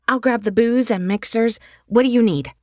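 eager, energetic friend planning fun; upbeat young woman. Acted. Riley offering drinks.

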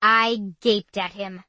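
ordinary delivery, nothing marked

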